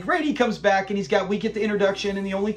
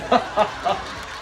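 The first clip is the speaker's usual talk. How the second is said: amused burst of laughter; real laugh; 2 semitones higher than usual. Real, not acted. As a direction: laugh at a sitcom gag. Reacts to played sitcom clip.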